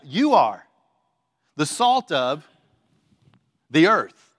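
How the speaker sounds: loud "You", then pauses before "the salt" and "the earth"; emphatic. Retold or imitated. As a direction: break it into weighty chunks, punching "You" and "the earth". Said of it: "So he emphasizes it that way".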